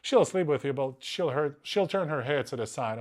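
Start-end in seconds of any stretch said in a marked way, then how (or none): none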